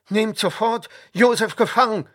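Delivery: high, loud enraged order; furious shout. A narrator's reading. Pontifar, enraged, orders Josef seized, shouting. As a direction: roar the command in anger. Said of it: "schrie er".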